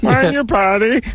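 high, squeaky Bugs Bunny cartoon alien. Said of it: "I sound like the, uh, alien from the, uh, from the Bugs Bunny cartoon".

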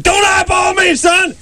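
high screamed drill sergeant order; drill sergeant shout. Retold or imitated. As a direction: scream it in the kid's face like a drill instructor. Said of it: "And I'm like".

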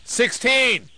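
sudden high, loud yell; abrupt yelp of a shouted announcement. Real, not acted. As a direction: yell it like someone just stepped on your foot. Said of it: "like you're saying sixteen when somebody stepped on your foot".

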